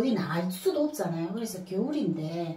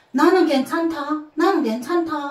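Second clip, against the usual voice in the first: put-on higher, slow, singsong child reassuring mom; imitating her son; 7 semitones higher, 7 dB louder than usual. Son reassuring his sorry mother, lightly voiced singsong boy. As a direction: sing it lightly like a boy insisting he's fine. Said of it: "이러는 거예요".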